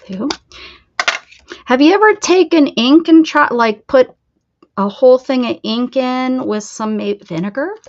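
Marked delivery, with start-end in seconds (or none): 1.6-7.7 much louder, animated question. Real, not acted. Eagerly asking chat about ink and vinegar. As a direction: call it out eagerly.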